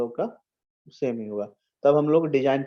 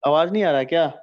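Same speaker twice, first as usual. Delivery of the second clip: calling out louder to ask if he can be heard; loud check-in call; 7 dB louder, faster than usual. Real, not acted. Teacher calls out to his online class, loudly. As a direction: raise the voice to reach students on a bad line.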